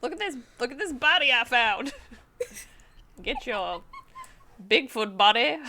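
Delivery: lightly voiced carnival barker, laughing; a half-hearted sideshow pitch; loud carnival barker. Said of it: "Carnival Barker sad impression".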